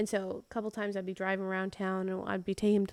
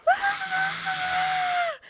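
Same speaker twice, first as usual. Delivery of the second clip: high-pitched, sustained "Ah!" scream; demonstrating scream; 5 dB louder than usual. Retold or imitated. Showing a boy in the car what screaming is, high demonstrating scream. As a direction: let out a long, high scream. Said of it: "screaming at the top of my lungs".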